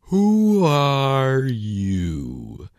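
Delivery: sleepy hookah-smoking caterpillar dragging out the question; languid, sleepy caterpillar. A narrator's reading. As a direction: stretch every word out like he can barely be bothered. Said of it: "in a languid, sleepy voice".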